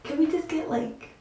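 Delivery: high, soft sweet-talking to mom. Acted. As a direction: sweeten it up, high and gentle, to ask a favor. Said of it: "put on my sweet voice".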